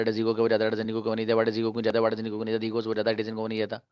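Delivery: very fast monotone conjugation chant; breakneck, even recitation without pauses; one-breath rapid drill. Shown as a demonstration. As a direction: rattle it off three times in one breath. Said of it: "इसको भी एक सांस में तीन बार पढ़ा जा सकता है".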